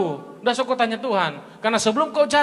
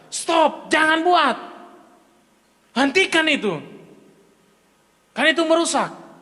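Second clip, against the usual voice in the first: firm self-command; 7 semitones higher than usual. Shown as a demonstration. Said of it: "berkata tegas dengan diri kita".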